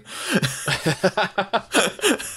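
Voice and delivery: a high, breathy burst of real laughter at his own punchline. Real, not acted. He laughs hard at the comic strip punchline, high burst of laughter.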